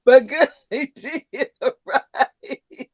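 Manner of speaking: can't stop laughing while explaining. Real, not acted. Grandma laughing at the toddler's bedtime argument.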